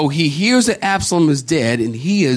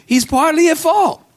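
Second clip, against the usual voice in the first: high, ringing emphatic call; preacher's high, ringing insistence; emphatic preaching; 13 semitones higher, 3 dB louder than usual. Real, not acted. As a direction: hammer the point home. Preacher hammering his point.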